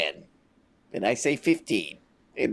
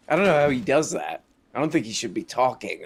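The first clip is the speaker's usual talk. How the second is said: normal talking voice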